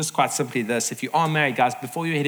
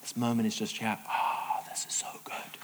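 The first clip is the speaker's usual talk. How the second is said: soft, low, near-whispered bliss; hushed savouring; 5 semitones lower, 9 dB quieter than usual. Acted. Parent savouring a sunset moment, hushed contentment. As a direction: sigh it quietly, soaking in the moment.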